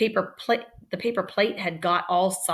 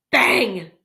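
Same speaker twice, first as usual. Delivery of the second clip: punchy bang; 6 dB louder than usual. Shown as a demonstration. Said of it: "Ooh, onomatopoeia".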